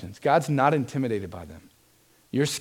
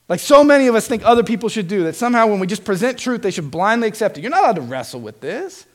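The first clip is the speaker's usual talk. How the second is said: ordinary talk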